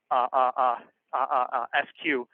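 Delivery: ordinary talk, nothing marked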